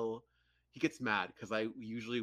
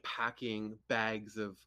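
ordinary talk

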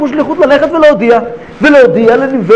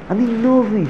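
ordinary talk, nothing marked

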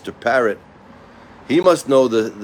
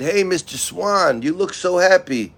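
crow's greeting; 7 semitones higher than usual. Acted. Crow greets the swan.

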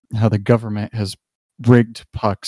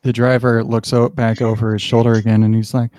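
normal talking voice

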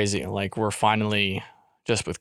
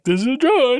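mock intro; 12 semitones higher, 5 dB louder, slower than usual. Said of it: "Surprised I just be like".

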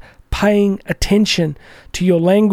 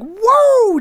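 high, drawn-out joyful whoop; re-enacted happy yell. Dad driving along with his kids, re-enacted happy yell. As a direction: whoop it out long and high, without full volume. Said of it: "yelling and laughing".

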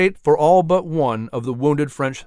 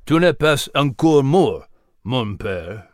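French words. Acted. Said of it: "Half King spoke in French".

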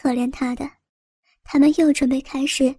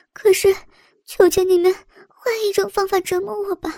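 high, rushed frantic begging; terrified babbling; 4 semitones higher, faster than usual. Acted. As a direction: babble in terror, pleading. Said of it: "她恐惧得开始语无伦次了".

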